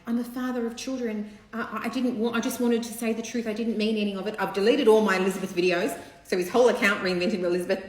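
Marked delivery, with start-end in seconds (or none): 0.1-6.0 pleading, self-pitying mock plea; lightly voiced defensive dad; whiny man voice. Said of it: "he's done a video to say".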